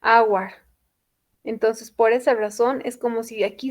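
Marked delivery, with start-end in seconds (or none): 0.0-0.5 "hour" opening on an A sound, H silent. Shown as a demonstration. Teacher showing why hour takes an, vowel-first sound. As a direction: start "hour" with a clear A. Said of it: "la O y la U es como A".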